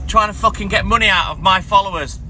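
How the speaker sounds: angry rant. Real, not acted. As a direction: vent at the clout chasers. Ranting at live co-host requests.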